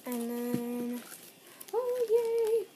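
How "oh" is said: high, loud, gushing squeals of joy; real over-the-top excitement, much higher and louder; excited squeals. Real, not acted. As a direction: squeal with delight at the gift. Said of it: "Sorry, so excited".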